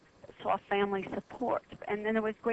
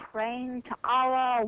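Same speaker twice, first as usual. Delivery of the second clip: ordinary talk, nothing marked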